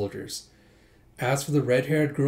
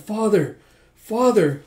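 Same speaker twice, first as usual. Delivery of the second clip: put-on higher, louder child's call; 8 semitones higher, 7 dB louder, slower than usual. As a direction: call out to your father like a boy. Child calling father in memory.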